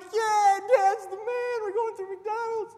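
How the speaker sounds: put-on high, loud kids cheering; excited kids. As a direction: cheer like thrilled little boys. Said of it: "And we're like".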